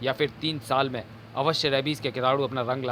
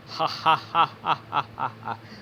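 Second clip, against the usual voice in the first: evil laugh. Acted. Villain gloats.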